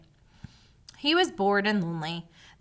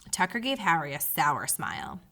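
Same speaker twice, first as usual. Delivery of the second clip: ordinary delivery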